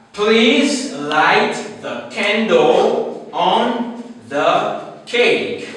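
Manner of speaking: slow sing-song read. Shown as a demonstration. Teacher chanting the example sentence for students. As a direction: lilt the sentence out slowly for learners. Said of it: "we use the first word with the sound K".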